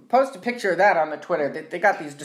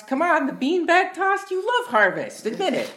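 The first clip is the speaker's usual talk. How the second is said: excited; 10 semitones higher than usual. Real, not acted. Coaxing cohost.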